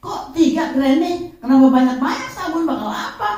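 put-on high, quick nagging child questions; fussy grandchild. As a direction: pester granny like a chatty grandchild. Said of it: "cucu saya aja udah cerewet". (cucu cerewet bertanya dengan suara tinggi)

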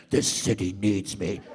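very low, slow growl of a brooding hero; Batman voice. Retold or imitated. Comic quoting Batman's line to the crowd in a very low slow growl. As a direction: drop deep and slow like the Dark Knight.

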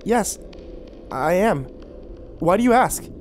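high, puzzled reply; confused. A narrator's reading. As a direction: answer warily, voice lifting. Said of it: "Frankie replied, confused".

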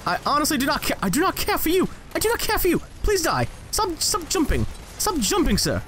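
high-pitched, exasperated British; accidental British accent. Real, not acted. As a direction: slip into British as you plead with the enemy. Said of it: "why am I British now?".

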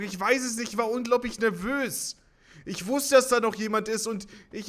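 high, loud pleading whine; half-joking whining; whiny excuse. Real, not acted. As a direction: excuse yourself mock-pathetically.

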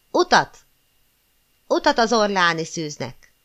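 loud cheering cry, falling on each call; crowd shouting. A narrator's reading. The crowd clearing the way for the Maid, loud cry. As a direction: call it out like a thousand voices. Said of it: "ezernyi hang kiáltotta".

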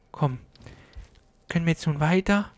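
usual speaking voice

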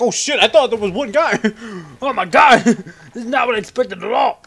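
high, loud, laughing soldier voice; mock soldier caught off guard, giggling; laughing enemy soldier. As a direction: play the surprised grunt, cracking up. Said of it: "He's like".

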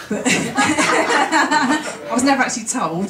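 half-laughing confession, let it spill out slowly. Real, not acted. Telling the class about her mum's diary, laughing slowly.

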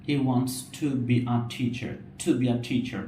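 to and teacher with a puff of air; slow, breathy t in teacher; aspirated t. Shown as a demonstration. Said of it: "অনেকটা ঠয়ের মতো".

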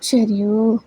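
usual speaking voice

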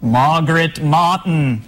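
put-on slow British accent, dropped R's; put-on British accent, stretched vowels. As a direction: echo the name in a drawn-out British accent. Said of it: "in a British accent as [name] would".

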